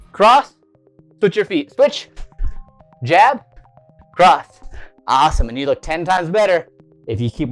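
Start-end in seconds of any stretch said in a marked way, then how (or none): 0.1-4.5 shouted drill commands. Shown as a demonstration. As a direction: bark out each strike. Calling out punch combo.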